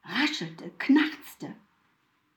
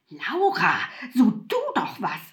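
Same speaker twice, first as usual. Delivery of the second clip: louder, urging her to act; urgent call; 4 dB louder than usual. A narrator's reading. Mutwillen urging frightened Laura, louder call. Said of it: "rief Mutwillen".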